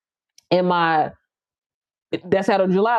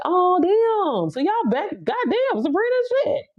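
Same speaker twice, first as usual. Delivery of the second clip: surprised; 10 semitones higher, slower than usual. Real, not acted. Surprised cursing at live stream arrival.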